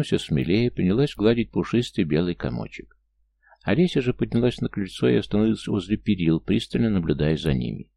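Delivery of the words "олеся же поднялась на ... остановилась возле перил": usual speaking voice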